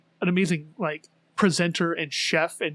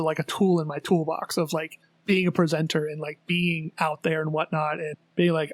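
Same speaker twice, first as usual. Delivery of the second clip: normal talking voice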